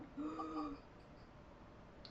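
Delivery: quick sharp gasp through the mouth. Shown as a demonstration. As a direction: suck air in sharply, mouth open. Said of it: "make the gasping sound".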